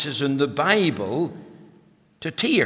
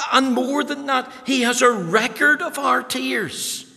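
soaring preaching; 7 semitones higher than usual. Acted. Pastor proclaiming God's record of tears, soaring. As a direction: lift it higher with every line.

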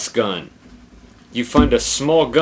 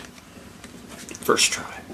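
whisper; 10 dB quieter than usual. Real, not acted. Whispered aside.

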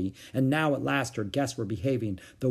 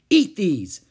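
put-on high, loud bossy child; shouted order; 7 semitones higher, 9 dB louder than usual. A narrator's reading. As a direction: shout it as a command. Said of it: "she shouted".

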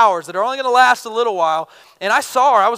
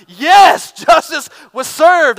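loud, high triumphant yell of victory; cheering shout; 7 semitones higher, 10 dB louder, much slower than usual. Retold or imitated. Preacher cheering a fighter's knockout punch, triumphant shout.

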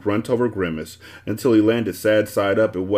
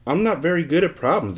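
higher-pitched small confession; sheepish admission; 8 semitones higher than usual. A narrator's reading. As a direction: own up to it mildly. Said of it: "admitted Milo".

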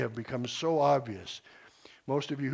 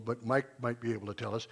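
ordinary delivery

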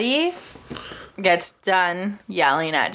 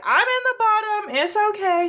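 Jasmine's breezy quote; 11 semitones higher than usual. Retold or imitated. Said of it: "Jasmine is like".

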